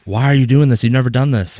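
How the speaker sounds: loud, high, exasperated shout at the donkey; angry yelling. Retold or imitated. Balaam yells angrily at his stubborn donkey. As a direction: bark it out, furious that the animal keeps straying. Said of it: "each time he gets mad at it".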